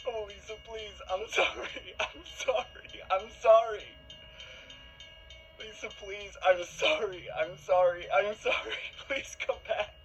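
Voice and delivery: high sobbing plea, surging loud; anguished begging. Acted. Boyfriend begging his girlfriend to come back, anguished and tearful. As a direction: break down into repeated desperate apologies.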